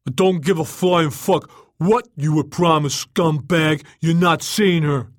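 angry yell. A narrator's reading. Bouncer refusing someone entry over a promise, angry yell. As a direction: yell it furiously, turning someone away. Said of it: "the bouncer yelled".

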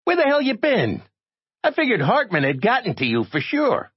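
very high, demanding voice; high-pitched, worked-up character; agitated reporter. A narrator's reading. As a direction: demand answers in a high little voice. Tiny reporter scolds Jay, worried.

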